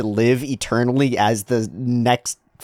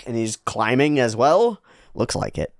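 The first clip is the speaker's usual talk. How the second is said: usual speaking voice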